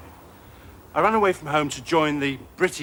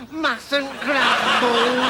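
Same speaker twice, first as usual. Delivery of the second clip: slow, higher, long-suffering mock sigh; mustn't grumble; 6 semitones higher, 4 dB louder, much slower than usual. Comic mocking complacent Brits, slow martyr drawl. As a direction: drag it out like a martyr.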